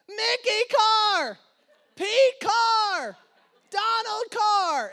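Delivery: put-on high, loud, slow drawn-out toddler wail; child's wail. Toddler crying out as his cars drop.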